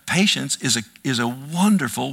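normal talking voice

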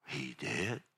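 hushed, low, wondering question; acted kid in quiet disbelief; almost breath-only astonishment; amazed child. Child amazed at dad's story.

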